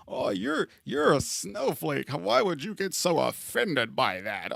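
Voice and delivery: high, sneering mock of a dismissive person. Mocking a person who calls people snowflakes, in a high taunting voice. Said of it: "Motherfuckers will really say".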